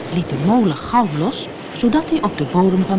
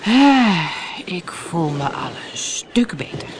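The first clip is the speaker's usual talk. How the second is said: sighing, whispery whale voice; relieved whale. A narrator's reading. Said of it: "hoorden ze de walvis zeggen".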